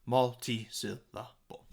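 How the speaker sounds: MULTI-SYLLABLE in five separate slow beats. Shown as a demonstration. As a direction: pause between each syllable of Multi-syllable. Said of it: "has five syllables".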